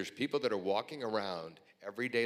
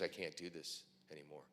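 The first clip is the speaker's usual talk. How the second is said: shaken; 3 semitones lower, 7 dB quieter than usual. Retold or imitated. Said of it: "visibly shaken".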